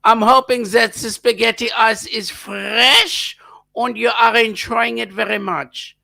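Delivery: hammed-up German accent for a viewer on a train. Streamer greeting a viewer in Germany, hammed-up German accent. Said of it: "so I'll put the accent on".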